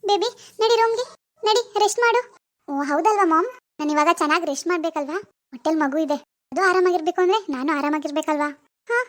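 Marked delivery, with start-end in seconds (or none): 2.7-8.5 put-on lower, hushed young woman lost in a delusion; disturbed daughter. Daughter Swathi's role voice.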